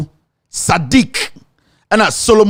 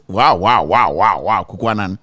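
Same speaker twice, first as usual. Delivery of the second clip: drawn-out wows sinking much lower each time; sarcastic wow that drops lower and lower; mock amazement; 10 semitones lower than usual. Real, not acted. Commentator mocking the party's candidate list, sarcastic falling wows.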